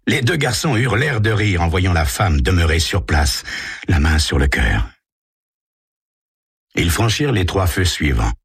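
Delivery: plain reading voice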